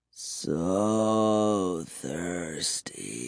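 very slow, low vampire moan; muttering. A narrator's reading. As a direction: drag each word out softly and deep. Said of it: "he kept muttering".

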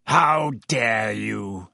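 slow, low, menacing growl. A narrator's reading. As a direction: growl it like a big dog. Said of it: "he growled".

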